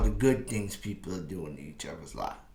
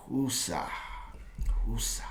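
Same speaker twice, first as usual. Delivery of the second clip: soft breathy self-soothing woosah; hushed calming-down chant; calming breath mantra; 2 semitones lower, 9 dB quieter than usual. Retold or imitated. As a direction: exhale it to calm yourself down. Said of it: "And I was like".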